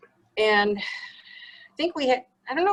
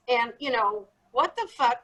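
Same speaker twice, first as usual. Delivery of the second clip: normal talking voice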